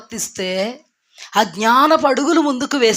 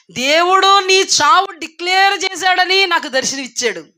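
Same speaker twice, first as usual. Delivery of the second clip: ordinary delivery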